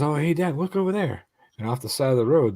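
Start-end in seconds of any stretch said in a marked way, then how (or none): 0.0-1.2 put-on lightly higher kid voice; a hint of an excited kid; voicing his son. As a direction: pitch up to play the young son. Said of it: "my son says".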